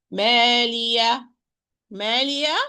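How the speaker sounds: "مالية" ending on an open aa with no h; wrong demo, vowel left open on "مالية"; wrong alif ending. Shown as a demonstration. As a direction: replace the final ha of "مالية" with a bare open vowel. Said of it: "I can say it wrongly".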